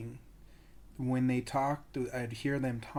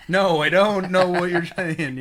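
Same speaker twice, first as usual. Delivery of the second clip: laughing protest; 6 semitones higher, 11 dB louder than usual. Retold or imitated. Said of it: "and I was like".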